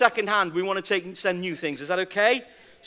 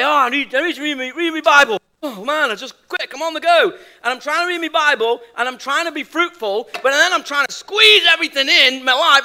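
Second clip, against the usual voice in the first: flustered busy person; 5 semitones higher, 6 dB louder than usual. Retold or imitated. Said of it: "we say".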